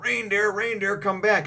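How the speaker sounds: high, loud chasing call. Retold or imitated. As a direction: call after him as he runs. Luffy chasing Chopper calls out.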